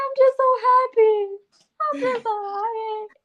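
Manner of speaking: high, wobbly crying-happy voice; mock happy crying. A woman retells crying happy tears on her cycle. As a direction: blubber it out happily. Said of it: "I said".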